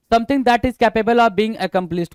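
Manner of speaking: reading the definition out slowly; slow dictation. Shown as a demonstration. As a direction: read it slowly so students can note it down. (धीमी आवाज़ में परिभाषा पढ़ना)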